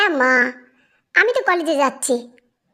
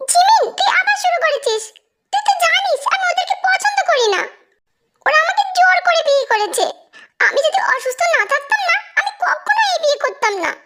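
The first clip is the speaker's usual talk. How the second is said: lightly voiced young man; 8 semitones higher than usual. Tae voiced high by narrator.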